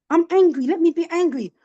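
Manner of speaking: high, loud insistence on being angry; mother claims her anger, loud and high; angry outburst. Retold or imitated. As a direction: demand the right to be angry. Mother voicing wish to vent.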